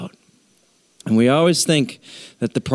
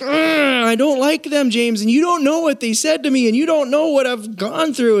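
much higher, whining protest; whiny churchgoer; 11 semitones higher than usual. Acted. Congregant pleading excuses to James in a whine. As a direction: complain like a defensive kid. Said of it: "And we're like".

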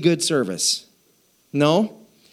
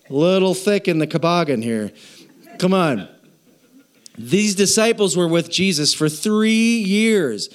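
ordinary talk

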